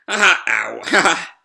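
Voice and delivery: a chuckle that ends on a wince, then laughs again; laugh, then catch the sore head; pained laugh. Acted.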